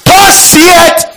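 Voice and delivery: high, loud proclamation; proclaiming. Acted. Preacher reading prophecy to congregation, loud proclaiming. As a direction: announce it with authority.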